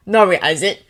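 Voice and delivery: loud, curt retort; snapped. A narrator's reading. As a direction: cut her off sharply. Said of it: "Weasley snapped".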